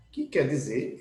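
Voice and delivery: ordinary talk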